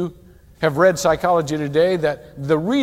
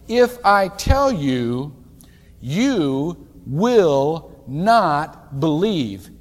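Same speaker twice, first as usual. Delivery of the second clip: slow solemn reading; 4 dB louder, much slower than usual. Shown as a demonstration. Reading Jesus' words, very slowly.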